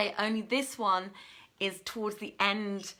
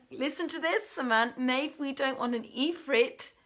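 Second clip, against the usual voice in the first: ordinary talk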